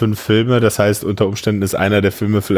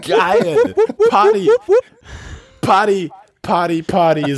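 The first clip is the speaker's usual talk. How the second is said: rowdy party animal. Pretend. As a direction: whip up the party crowd. Mock New Year party hype.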